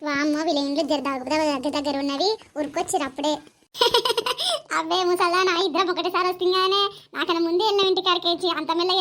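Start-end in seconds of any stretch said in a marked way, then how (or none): 3.8-4.7 high burst of laughter; bright teasing laugh. Acted. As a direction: laugh at the old woman. Village women joking at tap.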